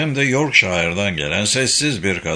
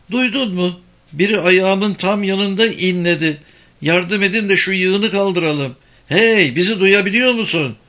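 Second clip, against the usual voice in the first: urgent calling; 8 semitones higher than usual. A narrator's reading. Rescuer calls to trapped survivor.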